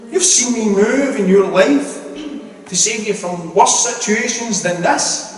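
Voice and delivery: high, loud preaching that drives the point home; rising to full force at the congregation; testimony preached up high, some lines slowed; impassioned preaching. Real, not acted.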